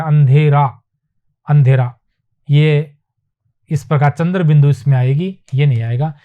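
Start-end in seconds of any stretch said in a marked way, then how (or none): 0.0-1.9 अंधेरा said firmly and louder; careful pronunciation. Shown as a demonstration. Teacher drilling अंधेरा for the class, louder. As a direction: hit अंधेरा clearly, no nasal dot. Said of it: "अंधेरा होता है".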